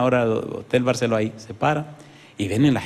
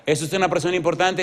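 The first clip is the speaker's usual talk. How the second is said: raised-pitch pressing question; high, urgent pulpit question; insistent question; 6 semitones higher than usual. Real, not acted. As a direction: press the congregation for an answer, voice up high. Repeats question, pressing congregation.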